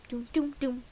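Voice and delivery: normal talking voice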